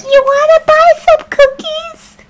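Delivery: very high squeaky scout voice; Girl Scout. Pretend. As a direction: pipe up, hammed-up cookie seller. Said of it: "looks like a freaking Girl Scout".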